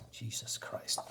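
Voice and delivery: whispered under the breath; exasperated mutter. Real, not acted. Reviewer fed up with the plot, exasperated whisper. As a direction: drop to a disgusted whisper. Exasperated aside after mocking reveal.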